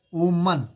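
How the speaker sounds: वुमन said as "oman", the o rounded into w; correct reading. Shown as a demonstration. Teacher shows students the right वुमन, said as "woo-mun". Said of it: "इसे वुमन पढ़ते हैं".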